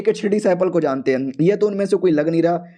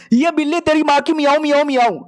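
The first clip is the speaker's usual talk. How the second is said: mock angry cursing; 8 semitones higher, 3 dB louder than usual. Hero swears at the cat over his losses, high mock-angry meowing. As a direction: curse the cat in a raised, playful voice.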